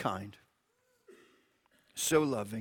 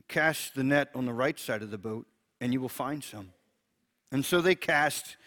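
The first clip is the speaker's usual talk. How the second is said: scripture reading; 4 dB louder than usual. Acted. Pastor reads John to listeners, a reverent scripture reading.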